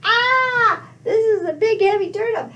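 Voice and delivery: high-pitched strained wail; crushed cry. Acted. Old man under the fallen turnip, high cry of alarm. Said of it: "He's like".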